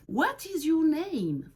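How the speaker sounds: drawn-out teacher voice; slow teacher question. Shown as a demonstration. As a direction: ask slowly for beginners. Teacher asks model question.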